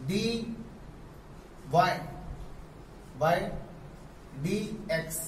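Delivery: teacher spelling out d y by d x; slow spelled-out reading. Shown as a demonstration. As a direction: read it out piece by piece, pausing. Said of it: "ऐसे पढ़ते हैं".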